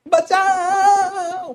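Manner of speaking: loud, high, long-drawn shout; drowning cry for help. Acted. Teacher acts himself drowning, surfacing to yell, long high shout. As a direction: shout it out, stretching the last vowel. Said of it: "चिल्लाता है".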